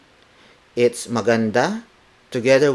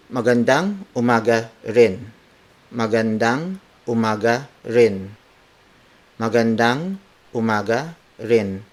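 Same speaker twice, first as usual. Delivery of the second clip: word for word. Shown as a demonstration. Teacher modelling the reply before adding intonation, word for word. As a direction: separate each word carefully at usual pitch. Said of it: "And then when you apply it".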